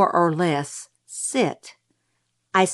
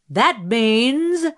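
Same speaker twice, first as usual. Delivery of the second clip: higher-pitched public oration; declaiming; 4 semitones higher, 3 dB louder than usual. A narrator's reading. Mrs. Merriweather translating for the rustic elements, declaiming. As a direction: announce it grandly from a lectern. Pageant speaker declaiming at lectern.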